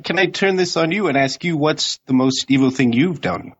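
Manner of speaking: ordinary delivery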